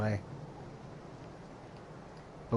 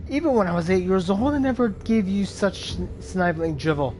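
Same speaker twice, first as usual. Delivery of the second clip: furious shout; 8 semitones higher than usual. Acted. Volko berates Bolverk for his pride, furious. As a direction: tear into the old friend, outraged.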